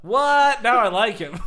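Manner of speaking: high, loud astonished outburst; real stunned cackle; shocked laughing. Real, not acted. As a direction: burst out in disbelief and laughter. Shocked by a wild claim.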